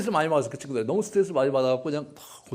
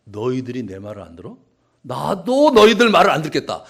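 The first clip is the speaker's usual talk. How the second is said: mock childish spite, then louder; petty tit for tat; 12 dB louder than usual. Preacher voicing God's childish comeback. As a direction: play God as a sulky kid. God voiced as petty squabbler.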